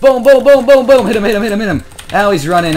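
loud, high-pitched attack shouting; excited battle yelling. Real, not acted. Player springing a surprise attack on an enemy, excited yelling.

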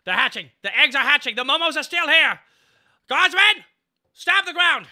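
frantic high shouted commands; gruff barked parade orders. Acted. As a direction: yell orders at the guardsmen, panicking. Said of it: "Even the speech text is like".

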